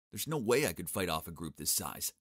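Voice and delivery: ordinary delivery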